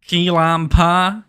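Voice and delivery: mock Southern drawl on food words; lightly put-on American South twang; a hint of a Southern accent. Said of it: "How good's my Southern accent?".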